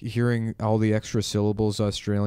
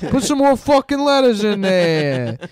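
loud, high, slow Italian voice through laughter; Italian tourist savouring the word; mock Italian; 10 semitones higher, 7 dB louder, slower than usual. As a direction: ham up a delighted Italian. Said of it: "They're like".

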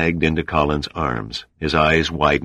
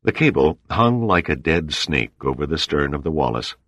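ordinary delivery, nothing marked

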